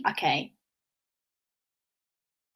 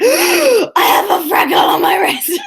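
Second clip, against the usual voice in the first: gasp, then a high, loud, drawn-out squeal; hammed-up squeal of astonishment; mock shocked discovery; 11 semitones higher, 8 dB louder, slower than usual. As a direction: act out an astonished, slow shriek. Retelling her shocked discovery.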